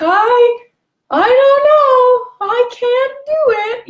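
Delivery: high, loud, whining helplessness; whiny victim voice. Retold or imitated. Passive aggressive person whining to the controller, high and helpless. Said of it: "And they're like".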